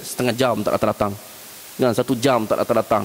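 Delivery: ordinary talk, nothing marked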